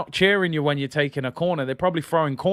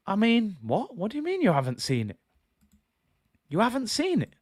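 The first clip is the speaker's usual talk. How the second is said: incredulous; 8 semitones higher, 3 dB quieter than usual. Real, not acted. Incredulous at manager's answer.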